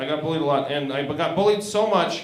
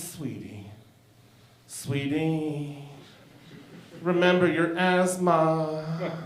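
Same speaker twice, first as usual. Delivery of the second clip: tender dad; much slower than usual. Retold or imitated. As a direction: coo it to calm an angry child. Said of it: "He goes".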